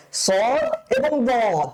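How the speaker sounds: সদ and বদ slow, both heavy and rounded; thick twin letters. Shown as a demonstration. Teacher asks students to notice the likeness, slow heavy সদ, বদ. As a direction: stretch সদ and বদ alike, thickly. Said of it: "এটাও মোটা করে".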